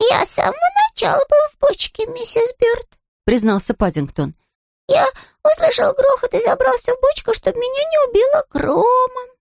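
very high small bear-cub voice; a polite little bear, earnest; little bear Paddington. A narrator's reading. Paddington explains to Mrs Bird, high bear-cub voice.

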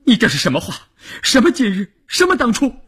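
high-pitched, indignant challenge; outraged retort. Acted. Wife challenges husband's regretful remark, shrilly outraged.

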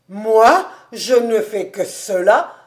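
loud, rising "Moi"; hearty, indignant comeback; mock-offended protest. A narrator's reading. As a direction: protest loudly in surprise. Kobus protests, amid tears of laughter.

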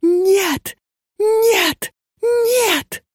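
desperate scream. A narrator's reading. Girl waking to strange women, screaming in despair. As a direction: scream no in terror. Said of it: "отчаянно закричала Леночка".

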